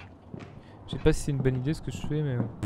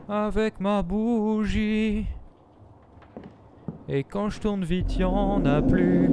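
slow, higher storyteller voice; mock dramatic narration; 5 semitones higher, much slower than usual. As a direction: narrate your plight slowly.

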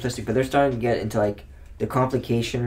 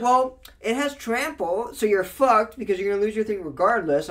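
smug opponent; 7 semitones higher than usual. Retold or imitated. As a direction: explain it like a smug know-it-all.

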